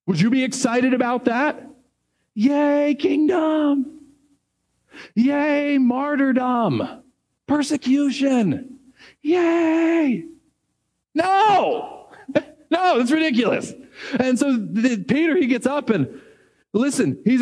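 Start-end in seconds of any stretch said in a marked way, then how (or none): 2.3-10.2 high mock cheers, dripping irony; sarcastic cheer. Preacher mocking excitement over martyrdom, sarcastic high cheering. 11.1-13.7 incredulous no. Real, not acted. Rejecting mock cheer.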